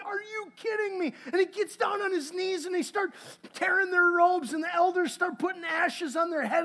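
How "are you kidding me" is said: exasperated Joshua. Retold or imitated. Preacher voicing Joshua at the defeat, exasperated shout. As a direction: throw the question up in disbelief. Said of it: "And Joshua's like".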